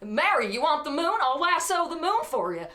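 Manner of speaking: Jimmy Stewart impression. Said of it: "That was really bad".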